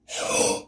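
stunned intake of breath; sharp gasp. Real, not acted. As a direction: gasp in real shock. Gets his favourite card.

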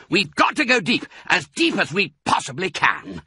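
high, pressing command; urgent order. A narrator's reading. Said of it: "ordered Mister Fox".

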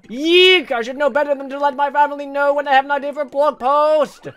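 high, loud shrieking cartoon voice; squeaky character voice. Reads character text in voice.

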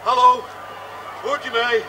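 one loud hello into the mic; calling out. Acted. Chauffeur calling the studio over the radio, loud call. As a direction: call out to get an answer.

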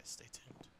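breathy whispered repeat. Real, not acted. Streamer signing off to viewers, whispered. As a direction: whisper it to yourself like an aside. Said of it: "So stay tuned".

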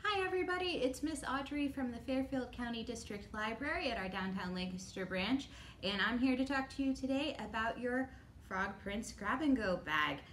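ordinary talk, nothing marked

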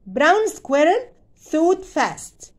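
usual speaking voice

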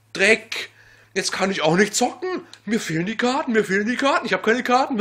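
loud, rising furious rant; building fury at missing cards; berserk rage. Real, not acted. Escalating rage. (immer lauter vor Wut)

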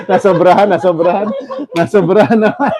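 laughing hard at an overdone move. Real, not acted. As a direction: giggle while teasing him.

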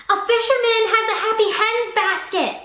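high, loud shout from afar; high loud shout of urgent news. A narrator's reading. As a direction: yell it across the market. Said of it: "he shouted".

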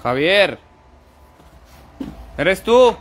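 loud call to a spirit. Real, not acted. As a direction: call the ghost by name. Calling to a spirit by name.

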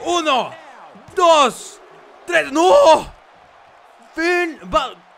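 shouting the count, then disbelief; excited pin count. Acted. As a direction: yell out the three count. Counting pin, shocked kickout.

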